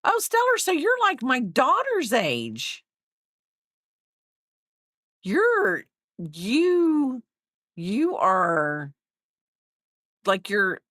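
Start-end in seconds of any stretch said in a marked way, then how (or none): none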